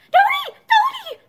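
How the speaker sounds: very high, loud calling; terrified child's cry. A narrator's reading. Boy turning to his mother for rescue, crying out in terror.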